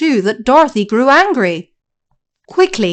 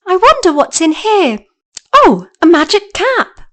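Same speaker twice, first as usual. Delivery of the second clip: put-on curious girl; 7 semitones higher than usual. Dorothy finds cap.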